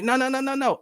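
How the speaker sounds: high, rapid-fire string of no's; protesting son. Retold or imitated. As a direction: cut mom off in mock protest. Son cuts off preaching mom.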